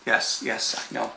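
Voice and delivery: very soft murmur to the dog; soothing a pet. Real, not acted. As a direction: humour the dog quietly. Soothing a dog demanding attention.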